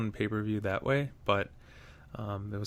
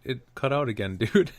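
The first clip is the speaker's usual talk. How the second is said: laughing; 4 semitones higher, 5 dB louder than usual. Real, not acted. Friend's call keeps cutting out.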